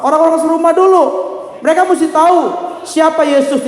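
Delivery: high, insistent pulpit teaching; rousing preacher's call; emphatic preaching. Real, not acted. Preaches the lesson in raised voice.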